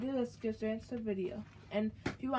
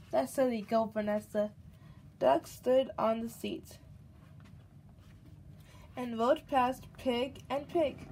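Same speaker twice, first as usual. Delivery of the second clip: lively, louder storybook read-aloud; reading to a child; 2 semitones higher, 4 dB louder, slower than usual. A narrator's reading. Mother reading a picture book to her baby, lively storybook voice. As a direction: read it out brightly for the little one.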